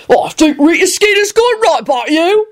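high-pitched hysterical constable voice; high, squawky indignant scream. A narrator's reading. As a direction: screech the parting shot as a flustered officer. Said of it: "screamed the constable".

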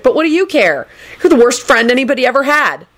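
high, indignant accusation; aggrieved reproach. A narrator's reading. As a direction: accuse a friend bitterly. Neville reproaches his friend.

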